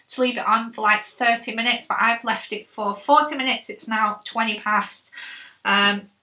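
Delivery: talking with limited jaw movement; muffled tight-jawed speech; jaw strapped shut. Real, not acted.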